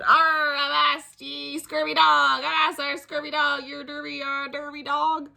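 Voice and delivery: bellowing pirate. Acted. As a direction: roar it like a toy pirate. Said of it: "pirate that bellowed".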